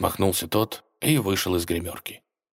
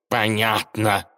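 cat bristling; 3 semitones higher, 3 dB louder, much slower than usual. Acted. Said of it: "вскинулся кот".

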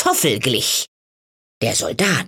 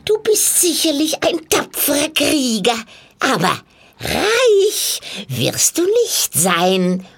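put-on tempting old witch; 9 semitones higher than usual. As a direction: coax the soldier as a high-voiced old hag. (lockende alte Hexe, hoch und kichernd)

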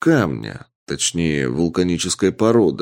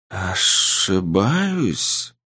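ancient being, amused and doubting; eldritch horror; much slower than usual. Acted. The entity questions him. (медленный нечеловеческий вопрос)

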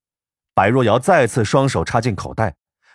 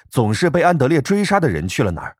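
ordinary delivery

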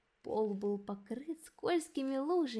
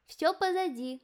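higher, louder called reassurance; calling out; 6 semitones higher, 4 dB louder than usual. A narrator's reading. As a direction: call out that it's over. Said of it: "кричала им Софи".

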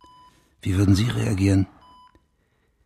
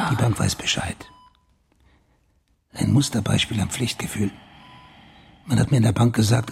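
ordinary delivery

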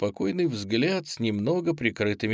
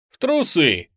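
higher, louder, cheeky answer; perky retort; 6 semitones higher, 5 dB louder than usual. A narrator's reading. Captor answering a toilet request, perky retort. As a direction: fire it back brightly. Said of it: "бодро отвечал Петров".

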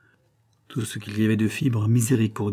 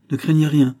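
louder, higher firm reassurance; injonction rassurante; 5 semitones higher, 7 dB louder than usual. A narrator's reading. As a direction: reassure her with firm authority. Gilbert rassure avec autorité. (injonction ferme, plus forte, pour rassurer)

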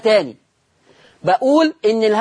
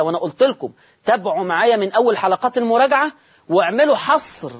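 ordinary talk, nothing marked

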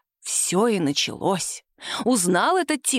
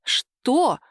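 higher, drawn-out questioning cry; narrated startled exclamation, pitched up; alarmed cry; 5 semitones higher, much slower than usual. A narrator's reading. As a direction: cry out in alarm without real shouting. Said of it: "закричали хором тётушка с Симой".

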